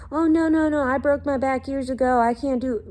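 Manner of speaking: high, whiny mock of her mother's excuse. Mimicking her mother's excuse about her back, high and whiny. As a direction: squeal out the excuse like a mocked parent.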